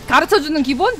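normal talking voice

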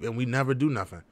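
normal talking voice

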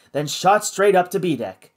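usual speaking voice

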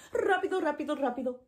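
rapid-fire rápido; fast. Shown as a demonstration. Teacher showing rápido by rattling it off fast. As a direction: race through the repeats. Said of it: "fast and slow".

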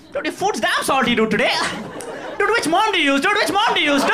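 high, laughing put-on whine; mock rude guest. Speaker mocking a host's food as a rude guest, high and laughing. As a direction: mockingly complain about the food. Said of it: "And so like".

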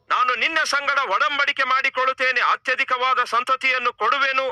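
high, sustained divine promise; voice of God. Acted. God promises Abraham countless offspring, high proclamation.

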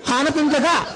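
high-pitched, hammed-up indignation; vengeful cat. Injured cat hunting a rat to get even, indignant. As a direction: squeal out a comic revenge plot.

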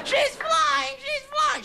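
put-on very high squeaky kid's voice; excited child. Child answering the heckler, high and squealing. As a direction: insist it like a delighted little kid. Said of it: "the children".

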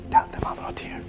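hushed, secretive whisper. Retold or imitated. Egyptian brother secretly asking the sheikh to cover for him, whispering. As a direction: whisper it as a furtive request.

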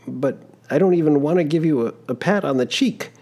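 put-on an embarrassed young man caught out; young traveler, flustered. Giovannino refuses to slap.

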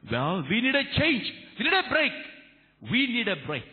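high, ringing sermon delivery; fervent preacher; impassioned preaching. Real, not acted. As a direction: proclaim it to the congregation, pitched high and emphatic. Preaching at full force.

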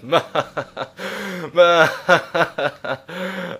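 long hammed-up villain cackle; playful "mwahaha"; mock evil laugh. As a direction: gloat with a drawn-out fake evil laugh. Mock villain gloating over viewers.

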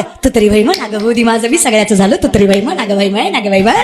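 low, begging voice of a penniless husband; pleading broke husband. Acted. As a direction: whine low and plead with the wife again and again. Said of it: "म्हणावं लागतं". (गरीब नवऱ्याचा खालच्या आवाजात विनवणी करणारा स्वर)

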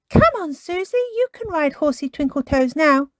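put-on high child character voice; Peppa, urging. A narrator's reading. Peppa eagerly offers friend a turn.

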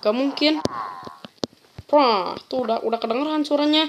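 usual speaking voice

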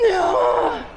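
high strained yelp under weight; strained cry. Real, not acted. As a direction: cry out as the rep fails. Failing a squat rep.

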